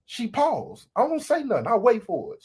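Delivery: ordinary delivery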